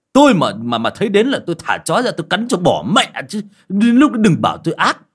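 high, loud indignant rant; furious. A narrator's reading. Insulted healer rants at the bald old man, loud and furious. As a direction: blast him with wounded pride. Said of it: "mặt đỏ tía tai".

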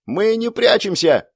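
высокий, замедленный звонкий выкрик; смело крикни чудовищу. Acted. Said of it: "звонко закричала Клэр". (Клэр дерзко кричит демону, звонко)

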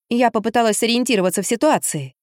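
plain reading voice

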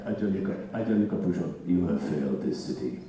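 put-on low Russian accent, dramatic line delivery. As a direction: growl it in a movie Russian accent. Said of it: "could you do the Russian accent".

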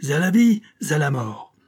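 high, loud opening, dropping after; defiant battle cry. A narrator's reading. Villain taking revenge, defiant loud cry. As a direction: proclaim it as a vow.

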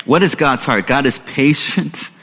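ordinary talk, nothing marked

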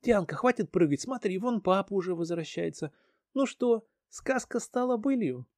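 high, lightly voiced mother; mother's voice. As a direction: speak as a cheerful mother. Mother speaks to daughter.

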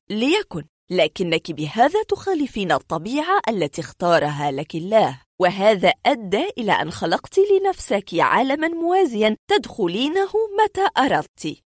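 ordinary delivery

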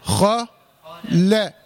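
heavy "خ", pause, then thin light "لا"; separated letters. Shown as a demonstration. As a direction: split "خ" and "لا", keeping the ل light. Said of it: "خلص الحروف من بعضها". (فصل الخاء المفخمة عن اللام المرققة "لا")

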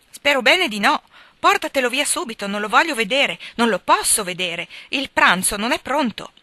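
high, loud, heated refusal; furious refusal. A narrator's reading. Meg angrily refuses to see the guest. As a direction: push the guest away in a fury.

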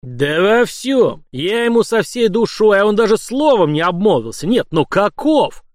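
loud, high, drawn-out outrage; indignant rant. A narrator's reading. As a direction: protest bitterly, still roused from roaring. Tsar raging at the betrayal. (возмущённо, громко, с растяжкой)

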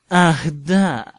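slow, loud mocking drawl; sneering. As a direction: savour the sneer at the boy. Snape mocks famous pupil.

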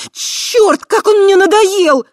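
high, loud, furious howl. A narrator's reading. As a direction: howl it in exasperation. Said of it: "взвыла Криста".

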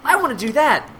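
put-on high excited child voice; eager kid volunteer. Kid volunteers at the improv show, high and eager. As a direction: pipe up like a keen kid. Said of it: "kids who were very enthusiastic volunteers".

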